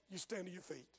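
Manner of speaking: ordinary talk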